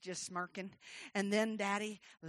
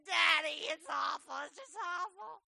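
mock-pitiful little girl; tearful child; 8 semitones higher, 5 dB louder than usual. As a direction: lightly whimper a complaint to Daddy. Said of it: "in the middle of a puddle of tears".

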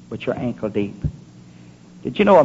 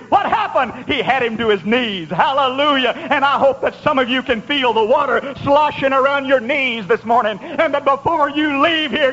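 high, urgent revival delivery; fervent preaching; 11 semitones higher than usual. Real, not acted. Preacher hoping some feel the water at their knees, fervent. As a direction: press the congregation with fervor.

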